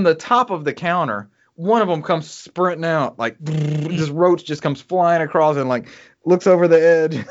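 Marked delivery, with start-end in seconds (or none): none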